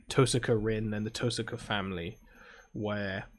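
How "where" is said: ordinary talk, nothing marked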